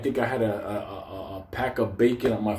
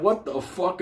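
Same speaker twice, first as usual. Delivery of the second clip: stunned; 8 semitones higher than usual. Retold or imitated. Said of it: "I'm like".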